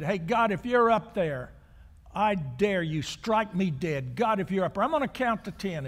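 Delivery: loud, high mocking dare to the sky; taunting shout at God. Atheist at the park speaker stand daring God, loud mocking shout. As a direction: yell up at heaven, daring God to strike. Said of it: "leaned back and said".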